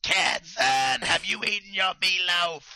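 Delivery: put-on shrill old mother calling her son; Howard's mother. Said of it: "Howard's mother on the podcast".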